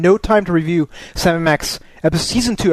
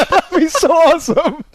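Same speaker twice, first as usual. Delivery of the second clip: laughing; 6 semitones higher, 3 dB louder, slower than usual. Real, not acted. Laughing excitement.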